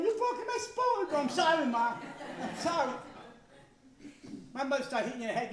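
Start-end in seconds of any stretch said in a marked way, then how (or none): 0.0-1.1 high, slow, hammed-up wail; mock crying mother. Mother mock-crying over her broken wooden spoon. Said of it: "she'd be crying". 1.1-2.9 put-on pleading kid.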